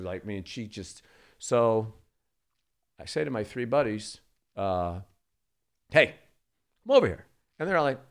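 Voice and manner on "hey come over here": calling out. Retold or imitated. Calling buddies over.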